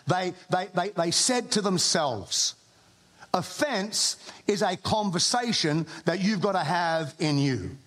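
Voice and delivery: ordinary talk, nothing marked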